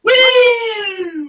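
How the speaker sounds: loud high cheer; high loud celebratory woo; cheering whoop. Real, not acted. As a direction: whoop for the milestone. Celebrating one hour mark.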